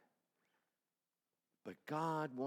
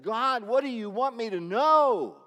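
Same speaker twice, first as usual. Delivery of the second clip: loud, high, slow cry; crying out to God; 11 semitones higher, 13 dB louder than usual. Retold or imitated. Pastor reliving prayer in the tree stand, loud cry. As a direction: cry out to God from a torn spirit. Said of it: "and I cried".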